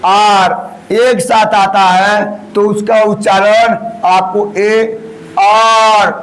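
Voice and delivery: usual speaking voice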